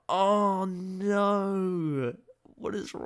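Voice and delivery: mock him with a shrill-pitched rebuttal; mocking reply. Retorting to Davis's complaint, high loud mockery. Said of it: "And it's like".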